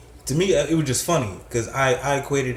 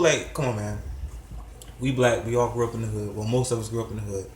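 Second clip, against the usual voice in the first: usual speaking voice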